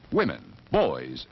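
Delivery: ordinary talk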